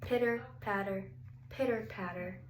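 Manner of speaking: plain reading voice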